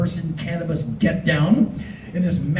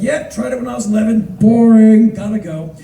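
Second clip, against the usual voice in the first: mock-bored brush-off; bored, dismissive; 5 semitones higher, 7 dB louder than usual. Dutch person shrugging off hash, imitated boredom. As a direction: wave it away, leaning on the dull word. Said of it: "Dutch people are bored of hash".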